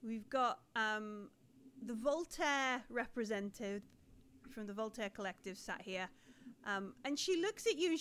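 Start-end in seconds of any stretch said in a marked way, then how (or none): none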